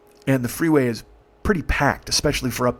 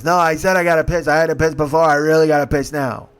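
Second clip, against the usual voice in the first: insistent outburst; 3 semitones higher, 5 dB louder than usual. Retold or imitated. Retold passenger insisting urgently.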